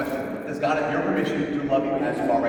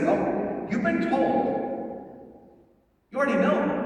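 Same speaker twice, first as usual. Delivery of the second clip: gentle God voice; 10 semitones higher, slower than usual. Retold or imitated. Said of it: "he's like".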